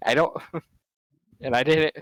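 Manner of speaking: talking through real laughter; words breaking up in laughs; laughing while telling it. Real, not acted. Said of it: "and I just start laughing".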